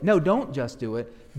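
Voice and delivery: ordinary talk, nothing marked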